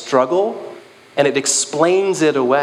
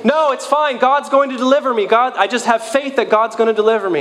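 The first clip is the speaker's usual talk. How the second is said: much higher, bright brush-off; cheery stubborn refusal; 9 semitones higher than usual. Retold or imitated. Stranded man cheerily turning down the boat, high-pitched. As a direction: cheerfully wave off the rescue. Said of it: "And he says".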